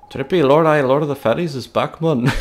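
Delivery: cheesy mock Scottish lord; horrible Scottish accent. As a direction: ham up a terrible Scottish brogue. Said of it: "I gotta do a horrible Scottish accent".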